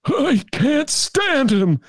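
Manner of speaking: put-on slow, high, drawn-out Chicago accent; mock a Chicago Democrat sneering at Trump. Chicago Dem dismissing Trump in a thick Chicago accent. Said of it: "That's my Chicago accent".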